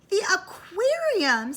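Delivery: put-on high, loud eager child; excited child Walter; excited question. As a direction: squeal it like a thrilled kid. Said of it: "said Iris's best friend, Walter".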